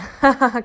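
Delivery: loud jeering cackle; mocking laugh. Duck jeering at Shelley, loud mocking laugh. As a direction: laugh at the silly tortoise.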